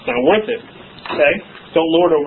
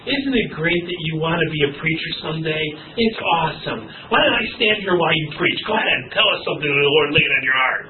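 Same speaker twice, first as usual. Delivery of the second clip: softer, lower, tender encouragement; gentle to a child; 3 semitones lower, 4 dB quieter than usual. Shown as a demonstration. Pastor holding a small kid, tender and encouraging. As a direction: coax a little child kindly. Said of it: "Hook him up in your arms and say".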